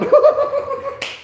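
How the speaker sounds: high burst of laughter; loud laugh. Real, not acted. After losing at basketball, real high laugh.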